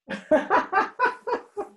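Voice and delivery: high-pitched run of laughter; amused high giggle. Real, not acted. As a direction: laugh in a high, rising string of ha-ha-ha. Laughs after recalling tube tongue.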